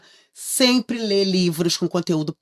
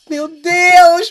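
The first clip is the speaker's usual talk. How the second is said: much higher and louder burst of joy; overjoyed cry; 13 semitones higher, 14 dB louder than usual. Retold or imitated. Mother reacting to her daughter's words with an overjoyed cry. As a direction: cry out in delighted disbelief.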